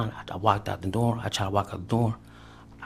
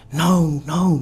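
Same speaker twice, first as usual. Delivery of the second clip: high, loud desperate refusal; shouted refusal; 8 semitones higher, 9 dB louder than usual. Retold or imitated. Girlfriend refusing to hand over the baby, shouted high and loud. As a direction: refuse him at the top of your voice. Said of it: "And she was like".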